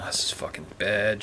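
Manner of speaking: hushed, unvoiced aside; whisper. Real, not acted. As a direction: whisper it, creeped out. Disturbed player reacting quietly.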